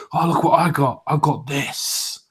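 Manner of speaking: put-on voice of a friend showing off, slowing at the end. Friend producing a new drug for mates, mock show-off. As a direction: imitate a tempting mate, drawing out the last words.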